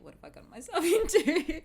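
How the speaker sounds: high, loud panic breaking into a laugh; comic panicked wail; laughing mock panic. Bride realising her mistake, in laughing mock panic. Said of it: "panic a little bit".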